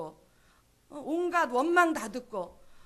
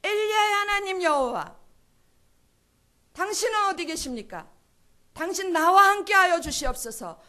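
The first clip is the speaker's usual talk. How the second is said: higher and louder, a desperate prayer; fervent crying out; 5 semitones higher, 8 dB louder than usual. Shown as a demonstration. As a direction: cry out to God with all your longing. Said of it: "얼마나 힘있게 이 부르짖었겠어요". (간절하게 부르짖는 기도)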